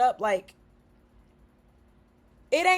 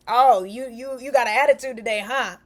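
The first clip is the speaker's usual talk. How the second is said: teasing, louder start, needling tone; mock accusation; 3 dB louder than usual. Imitating someone teasing a friend about attitude. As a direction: needle them like it's a joke.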